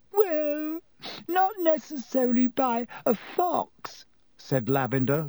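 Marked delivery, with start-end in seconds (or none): 0.1-4.1 high, weepy protest; tearful. A narrator's reading. Lavender answering Hermione through tears, weepy. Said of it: "with streaming eyes".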